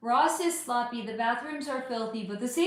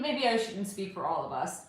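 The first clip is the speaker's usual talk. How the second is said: normal talking voice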